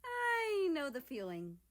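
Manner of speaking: lightly voiced hesitant Japan. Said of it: "Japan began hesitantly".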